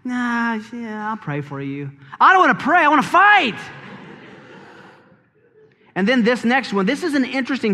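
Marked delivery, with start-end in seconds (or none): 0.1-1.9 soft dismissive reply. Retold or imitated. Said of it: "they just smile and said". 2.2-3.6 higher, louder petulant protest; mock indignant fighter. Churchgoer craving an argument, whining loudly. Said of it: "You know".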